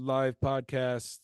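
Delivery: slow Trump-style drawl. Retold or imitated. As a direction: draw it out like Trump. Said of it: "Why'd you sound like Trump there?".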